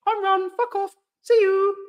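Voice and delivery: very high, chirpy send-off; mocking sing-song. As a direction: wave them off in a squeaky mock voice. Mocking commenter off the show.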